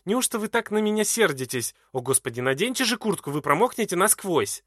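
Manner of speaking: high, loud distressed pleading; pleading cry. A narrator's reading. Edwarda pleads loudly with angry Glahn to cover himself. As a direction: beg him, near tears. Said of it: "кричит она".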